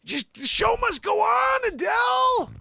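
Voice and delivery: very high, impassioned mock wail; theatrical exhortation. Host urging [name] onstage, high theatrical shout. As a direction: cry it out, stretching the final line.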